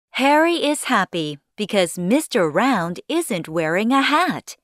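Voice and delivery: plain reading voice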